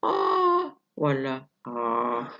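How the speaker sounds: first ah sustained high, second dropped low; same loudness, two different pitches; high versus low pitch. Shown as a demonstration. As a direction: hold a high tone, then a low one. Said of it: "بس واحد طبقة عالية".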